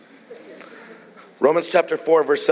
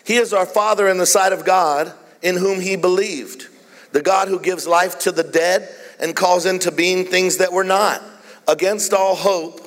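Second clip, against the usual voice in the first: raised, emphatic reading voice; scripture reading; 4 semitones higher than usual. Real, not acted. Preacher reading Romans to the congregation, higher declarative reading. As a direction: read it out in measured phrases, pitched up.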